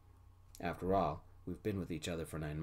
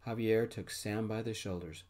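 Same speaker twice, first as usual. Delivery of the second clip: ordinary delivery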